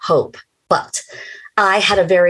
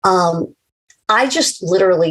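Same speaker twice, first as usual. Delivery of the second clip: ordinary talk, nothing marked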